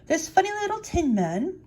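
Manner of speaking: high, slow mouse queen voice. A narrator's reading. Queen answering her mice, high-voiced. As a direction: lift the voice high and stretch it out. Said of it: "she answered".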